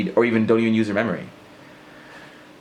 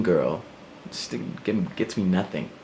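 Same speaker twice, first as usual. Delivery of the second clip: normal talking voice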